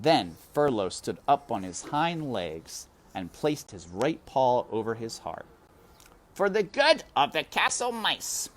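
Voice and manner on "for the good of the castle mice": high, loud ceremonial proclamation; high, loud formal mouse proclamation; solemn announcement. A narrator's reading. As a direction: proclaim it like a herald into the dark. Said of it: "he announced to the darkness".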